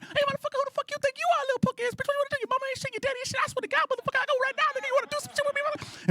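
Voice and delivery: put-on squeaky, very high, rapid-fire kid voices; swearing little kids. Little kids in the street swearing at each other, squeaky mock tirade. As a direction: imitate little brats cursing each other out. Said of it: "And they kept going".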